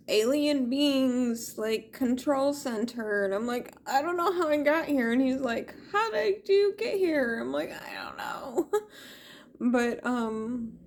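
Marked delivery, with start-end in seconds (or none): 3.9-5.1 high, fast flustered answer; rushed flustered reply. Retold or imitated. She answers the alien, high fast flustered. 5.9-7.4 high questioning alien; mock entity voice. Alien being questions the intruder, high mock voice. 7.7-8.7 small whispered I don't know; sheepish whisper. Retold or imitated. She answers the alien in a sheepish whisper.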